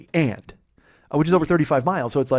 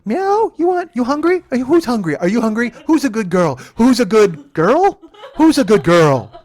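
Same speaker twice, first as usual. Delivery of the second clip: high, loud, hammed-up pet talk; talking to a cat; 9 semitones higher, 8 dB louder than usual. Driver treating passenger like a cat, mock pet talk. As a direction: coo at it like a silly cat owner. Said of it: "It's like talking to a cat".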